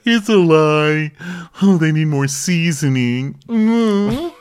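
hammed-up imitation of a chef griping; mock chef complaint. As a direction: whine it like a fussy chef.

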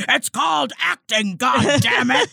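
angry exasperated outburst, imitated; mock-furious scolding that rises into a shout; Brian Cox. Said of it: "how angry Brian Cox gets".

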